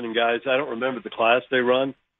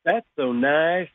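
slow syrupy Southern sweetness, a dagger behind it; sugary Southern drawl; 4 semitones higher, 3 dB louder, much slower than usual. Retold or imitated. Southern girl to another Southern girl, slow sugary fake sweetness. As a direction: drawl it out sweet as pie, meaning the opposite. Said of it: "Southern girls have to say to other Southern girls".

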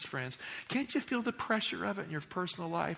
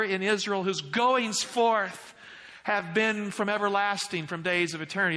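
declaimed scripture; 5 semitones higher, 4 dB louder than usual. Acted. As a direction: proclaim it with swelling weight.

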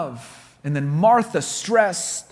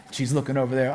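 normal talking voice

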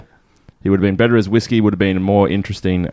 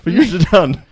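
put-on silly childish voice; 8 semitones higher, 3 dB louder than usual. Repeating the silly voice.

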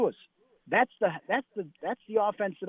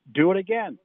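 louder, commanding do it again; coach's barked order; 4 semitones higher, 7 dB louder than usual. Retold or imitated. Coach orders veteran tight end to repeat the play, loud command. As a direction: order the drill redone, sharp and loud.

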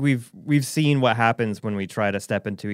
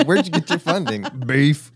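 beef industry rancher; 3 semitones higher than usual. Acted. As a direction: play a caricature TV rancher. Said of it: "Is that how you envision the beef industry to sound in conversation?".

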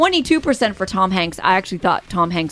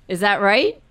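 ordinary talk

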